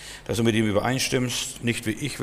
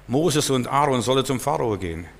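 normal talking voice

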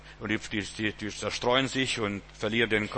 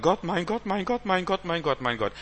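high-pitched, repetitive wail to God; hammed-up desperate bawling, sinking at the end; mock wailing; 7 semitones higher than usual. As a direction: imitate someone whining and pleading in panic. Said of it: "soll es aufhören zu plärren".

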